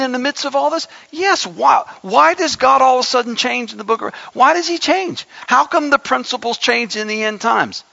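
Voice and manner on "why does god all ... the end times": normal talking voice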